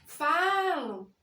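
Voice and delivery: "falam" called out loud with a long first syllable; simulated shout. Shown as a demonstration. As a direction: stretch the FA of "falam" like a mock yell. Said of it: "simulando um grito".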